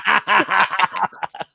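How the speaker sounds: high burst of laughter; loud laugh. Real, not acted. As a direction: let out a big laugh. Laughter after the chant.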